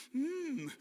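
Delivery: high, soft "mmm" of relish; drawn-out mock delight; yummy hum. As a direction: hum as if tasting cake. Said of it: "we're gonna go".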